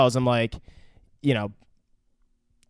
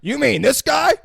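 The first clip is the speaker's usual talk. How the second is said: mock hobo taunt; 10 semitones higher, 6 dB louder than usual. Hobo mocking Santa.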